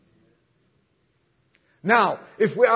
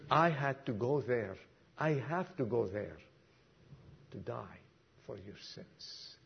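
the line sinks lower and softer until it is only breath; fading to a whisper; 7 semitones lower, 14 dB quieter than usual. Retold or imitated. Jesus tells Peter he must die, fading to a whisper. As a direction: let Jesus' answer fade into a whisper.

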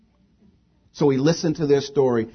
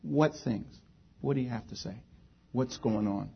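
very soft, unforced questions; gentle questioning; 10 dB quieter than usual. Retold or imitated. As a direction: ask quietly, leaving room for an answer. Jesus asks gently instead of scolding.

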